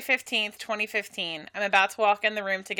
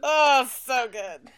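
slow teaser voice; 3 semitones higher, much slower than usual. Pretend. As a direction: stretch it slowly, teasingly. Echoing the teaser line.

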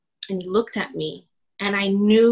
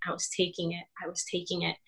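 each repeat softer, sinking to a hush; fading repetition; 11 dB quieter, much faster than usual. Real, not acted. Hurt pastor's wife admitting she absorbed it, fading softer. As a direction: let it fade away with each repeat.

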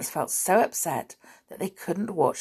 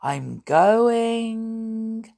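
long-drawn, raised eerie call; starting to fade away; 5 semitones higher, 5 dB louder than usual. A narrator's reading. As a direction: stretch the word going like a departing voice. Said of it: "His voice got softer and softer".

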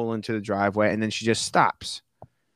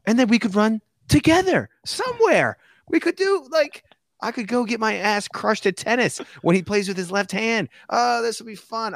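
high, giddy babble; excited; 10 semitones higher than usual. Retold or imitated. Narrator dreaming of living near friend, high and giddy. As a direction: gush with rising joy.

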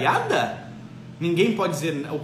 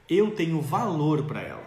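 ordinary delivery

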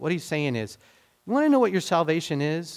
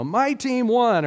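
higher-pitched, drawn-out cheer; mock exclamation; 8 semitones higher, 3 dB louder, slower than usual. Preacher showing listeners how people mark emphasis, mock exclamation. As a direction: call it out like a sports fan's boast.

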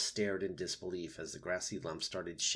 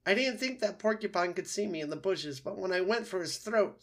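high, loud whining fox; wounded fox, distressed; 11 semitones higher, 5 dB louder than usual. A narrator's reading. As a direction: wail out the story of the accident.

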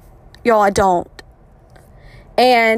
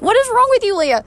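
mock exasperation, pitched much higher; exasperated self-scolding; 11 semitones higher than usual. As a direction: scold yourself in a high, incredulous voice. Scolding herself in retold thought.

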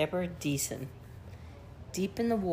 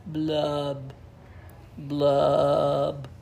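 glum blub; 3 semitones lower, 9 dB louder, much slower than usual. Acted. Fish's gloomy bubbling refrain.

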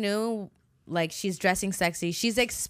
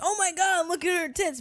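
much higher mock gawking; crude guy; 10 semitones higher than usual. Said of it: "you need guys like that to be like".

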